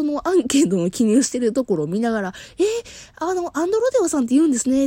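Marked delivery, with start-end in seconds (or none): 2.6-4.9 high, quick, bright clerk voice; chatty clerk. Retold or imitated. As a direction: gush like an eager young clerk. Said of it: "って言いながら".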